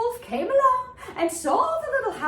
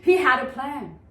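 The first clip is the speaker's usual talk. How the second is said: ordinary delivery, nothing marked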